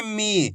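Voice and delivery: "मी" with a long, held ee; drawn-out vowel. Shown as a demonstration. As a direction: stretch out the vowel of "मी". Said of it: "खींच खींच के पढ़ेंगे".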